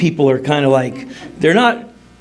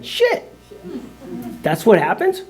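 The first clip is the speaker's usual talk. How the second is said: surprised; 8 semitones higher, 4 dB quieter than usual. Retold or imitated. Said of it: "I'm like".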